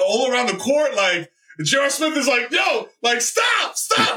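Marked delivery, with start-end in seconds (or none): 2.5-4.2 high mock yell of stop; a teammate yelling at the shooter, high mock shout; retold scream. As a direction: call out to a teammate to stop the play. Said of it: "like, screaming".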